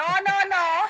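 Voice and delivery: ordinary delivery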